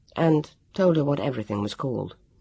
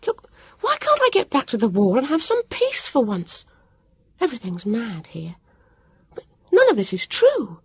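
high, upset outburst building; a girl losing her grip on calm; high and rising, close to a cry; voice getting louder and louder; 7 semitones higher than usual. A narrator's reading. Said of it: "Her voice was getting louder and louder".

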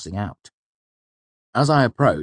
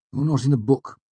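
higher-pitched, brisk brush-off; curt dismissal; 8 semitones higher, 3 dB louder than usual. A narrator's reading. Clerk turns away applicant, curt and dismissive. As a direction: dismiss him without interest.

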